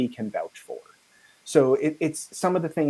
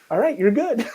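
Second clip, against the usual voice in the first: high, bright mock-approval of the outfit; approving fixer; 10 semitones higher, 4 dB louder than usual. Airport fixer telling the traveller he's fine, higher voice. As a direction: sign off on the outfit, pleased.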